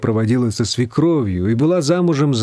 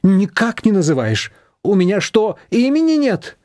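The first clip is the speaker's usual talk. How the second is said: higher, hurt reproachful voice rising at the end; aggrieved mother-in-law; 7 semitones higher than usual. A narrator's reading. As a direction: demand to be named. Manana keeps reproaching.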